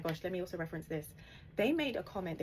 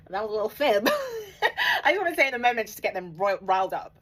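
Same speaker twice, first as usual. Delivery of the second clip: loud giggling through the confession; laughing; 5 semitones higher, 10 dB louder than usual. Real, not acted. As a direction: laugh it out while owning up to the fib. Laughing while admitting a fib.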